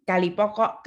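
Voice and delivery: usual speaking voice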